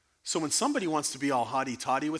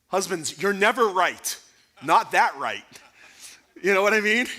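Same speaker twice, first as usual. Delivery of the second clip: emphatic teasing; 6 semitones higher, 8 dB louder than usual. Real, not acted. Joking jab at husbands.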